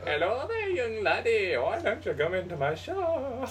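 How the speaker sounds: high, put-on creepy half-German shopkeeper voice; hammed-up creepy German-ish accent luring a customer. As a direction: lure him into the shop, sleazy.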